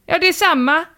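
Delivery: high-pitched called-out reply; mock shout. Poster returns neighbour's new year greeting, mock shout. Retold calling out from a window.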